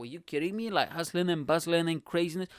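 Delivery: ordinary talk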